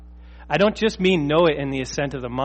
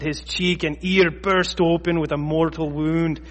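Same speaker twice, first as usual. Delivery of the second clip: attempted Scottish accent. Preacher reading Peter's sword attack to congregation, attempted Scottish accent. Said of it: "read it in a Scottish accent".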